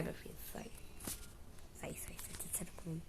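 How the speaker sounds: urgent whisper. Real, not acted. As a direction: hiss it under your breath. Urging the character away.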